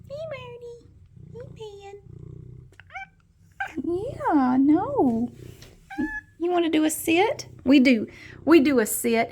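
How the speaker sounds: high, loud coo at the pet; pet talk. Real, not acted. Greeting pet Marty, high cooing pet talk.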